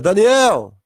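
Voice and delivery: loud call on the name; calling shout. Acted. Jesus spotting Daniel in paradise, loud calling shout. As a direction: shout the name to get his attention. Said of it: "Ele olha e grita".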